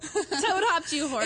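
giggling teasing chant; laughing tease. Real, not acted. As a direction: tease her while laughing.

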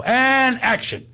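high, drawn-out set call; director calling action. Retold or imitated. Film crew starts a take, drawn-out director's call. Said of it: "So they, they go".

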